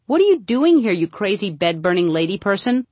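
high-pitched comic outburst that eases off; Jerry Lewis. Acted. Mad host to the party guest, mock high-pitched scream. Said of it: "screaming in a high-pitched voice".

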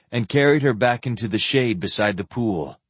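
ordinary delivery

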